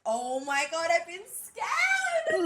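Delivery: high, slow panicked shout; stranded at the airport, shrieking in panic. Retold or imitated. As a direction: shriek it like you've just been scammed. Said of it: "And I was like".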